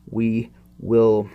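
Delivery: usual speaking voice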